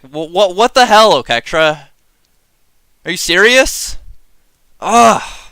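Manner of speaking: half-real, half-joking indignation; worked-up anger. Real, not acted. Podcaster scolds Oketra for not being a cat, exasperated. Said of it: "I'm actually getting myself angry".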